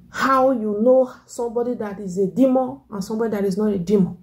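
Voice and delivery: normal talking voice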